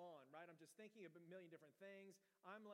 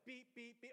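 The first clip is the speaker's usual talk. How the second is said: rapid run of higher-pitched beeps; faster alarm beeps; 6 semitones higher than usual. Retold or imitated. Pastor imitating the alarm after hitting it again, rapid high beeps. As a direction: fire off beeps like a frantic alarm. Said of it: "it starts beeping faster".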